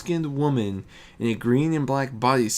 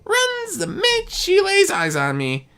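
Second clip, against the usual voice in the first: put-on much higher, louder young trickster voice; Discord as a boastful youth; 9 dB louder than usual. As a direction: brag it like a mischievous young god. Discord's voice, held.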